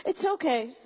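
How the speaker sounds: usual speaking voice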